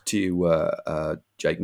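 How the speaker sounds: ordinary talk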